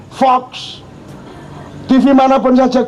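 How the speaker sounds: ordinary talk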